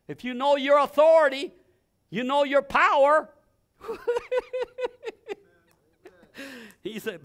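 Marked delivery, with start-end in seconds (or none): 3.8-5.3 a high burst of laughter; delighted laugh. Real, not acted. Laughs at own point.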